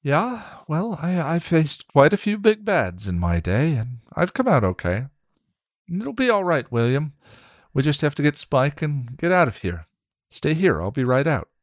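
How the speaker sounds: high, bright, reassuring voice; confident young woman. Acted. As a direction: reassure him, upbeat and high.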